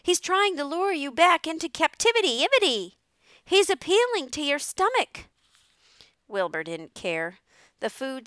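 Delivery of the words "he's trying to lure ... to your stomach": high, loud, frantic goose voice; warning goose. A narrator's reading. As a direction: warn urgently in a squawking goose voice. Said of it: "said the goose".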